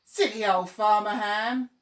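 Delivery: taunting the farmer at full voice; loud playground jeer; jeering shout. Acted. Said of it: "started shouting".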